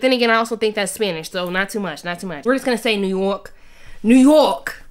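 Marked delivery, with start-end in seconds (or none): 4.0-4.8 loud yelling chant; mock yell. Jeering in a mock New York crowd voice.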